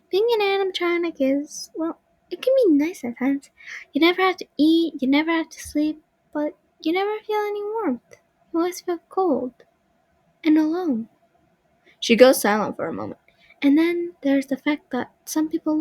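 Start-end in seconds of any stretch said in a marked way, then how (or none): 0.1-11.1 put-on high, light little girl puppet voice; a small girl's voice, wistful and high. Marionette describing her cold lonely life in a high girl voice.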